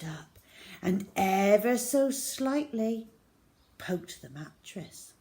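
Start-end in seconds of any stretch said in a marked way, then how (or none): none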